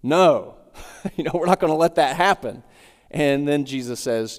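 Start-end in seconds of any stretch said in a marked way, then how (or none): 0.0-2.6 laughing mock protest. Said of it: "Peter follows his successful statement with the statement".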